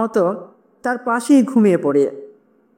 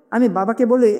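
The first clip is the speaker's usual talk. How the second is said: ordinary delivery, nothing marked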